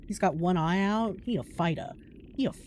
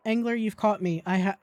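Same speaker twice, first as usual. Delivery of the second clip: ordinary delivery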